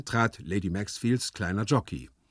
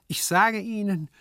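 high-pitched, placid lecturing voice; composed, raised-pitch remonstrance; philosophical composure; 11 semitones higher than usual. Acted. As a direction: explain with philosophical unconcern. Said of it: "mit philosophischer Gelassenheit".

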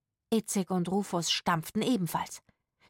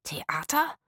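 questioning echo with a rising end; surprised; 3 semitones higher than usual. A narrator's reading. Rufus hearing it is only theatre, surprised. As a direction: repeat it in puzzled disbelief. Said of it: "blickten sich überrascht an".

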